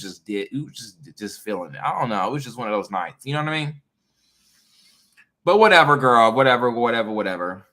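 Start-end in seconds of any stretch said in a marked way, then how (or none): 5.4-7.7 loud dismissive brush-off; loud whatever. Real, not acted. Shrugging off the topic, loud and dismissive. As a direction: toss it away, loud.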